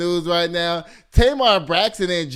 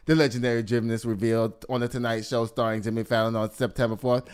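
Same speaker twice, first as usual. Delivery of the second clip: ordinary talk, nothing marked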